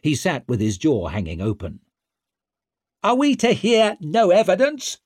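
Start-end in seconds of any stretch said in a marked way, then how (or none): 3.0-5.0 high, loud, indignant challenge; raised-voice protest; calling out. A narrator's reading. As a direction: call it out across the chamber. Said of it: "called out Huygen".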